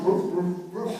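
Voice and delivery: short, low woofs with gaps; imitated barking dog. Neighbor's dog going all night, imitated barking. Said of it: "It's like that dog".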